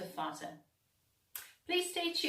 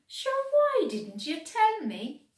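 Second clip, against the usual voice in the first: higher and louder, eager question; excited character; 11 semitones higher, 7 dB louder than usual. A narrator's reading. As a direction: pipe up with delight. Character reacts in story.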